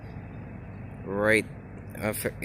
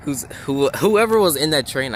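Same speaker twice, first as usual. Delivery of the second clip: tripping over words, laughing; can't get the words out; stumbling over words; 3 semitones higher, 4 dB louder than usual. Real, not acted. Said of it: "I cannot speak today".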